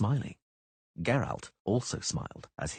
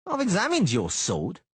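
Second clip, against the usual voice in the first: high, loud mocking thug; taunting sneering bodyguard; Cicada voice; 11 semitones higher, 4 dB louder than usual.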